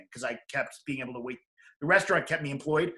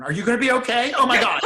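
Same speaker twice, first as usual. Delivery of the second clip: higher, louder mock panic; panicking; 9 semitones higher, 11 dB louder than usual. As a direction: imitate a flustered helpline rookie freaking out. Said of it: "He started panicking".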